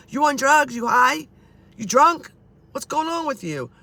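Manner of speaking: high, loud rapid-fire questions; acted incredulous questioning. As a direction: grill Dad, acting out disbelief. Acting out her questioning of Dad.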